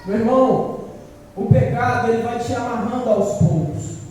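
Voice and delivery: loud, forceful warning from the pulpit; fiery preaching. Acted. Preacher warning his brothers about sin, loud and forceful. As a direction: preach it hard at the congregation. Preacher builds on sin's seduction.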